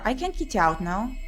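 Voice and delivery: normal talking voice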